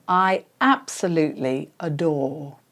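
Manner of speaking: broken-down practice chunk. Shown as a demonstration. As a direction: give "I absolutely adore" in careful, measured words for copying. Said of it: "you would then start by saying".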